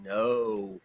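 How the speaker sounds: low, very soft mock Mexican accent; quiet deadpan Mexican-accented refusal; imitated Mexican voice. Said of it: "in a very Mexican voice".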